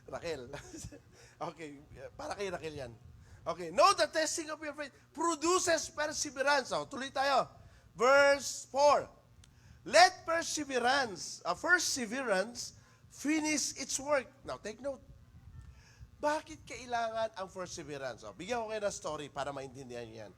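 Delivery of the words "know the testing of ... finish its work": loud, slow preaching of the verse; scripture reading. Acted. Preacher reading the verse to the congregation, loud and slow. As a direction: proclaim the verse with force.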